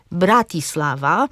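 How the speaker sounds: "Bratislava" stressed on BRA, Slovak-style; stress first syllable. Shown as a demonstration. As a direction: hit the opening syllable of "Bratislava". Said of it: "akcent pada na pierwszą sylabę wyrazu". („Bratislava” z akcentem na BRA)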